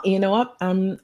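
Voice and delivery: ordinary delivery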